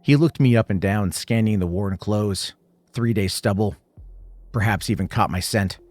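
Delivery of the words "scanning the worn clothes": ordinary delivery, nothing marked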